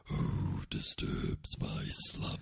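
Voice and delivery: deep, slow, raspy whispered rumble; the Cave of Wonders booming from the sand; modulated cave voice. Retold or imitated. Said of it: "I'll do it right now for you".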